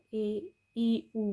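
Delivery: "اے ای او" sounded out vowel by vowel; umlaut pronunciation. Shown as a demonstration. Teacher showing learners the u-umlaut, sounding out "اے ای او". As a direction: spell out the sound "اے ای او" with small gaps. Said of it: "بولا جائے گا".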